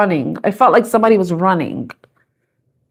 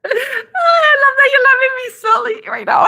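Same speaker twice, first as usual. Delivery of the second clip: high squeal through laughter; very high, giggly outburst; helpless giggling; 14 semitones higher than usual. Real, not acted. Giggling at her own silly accent.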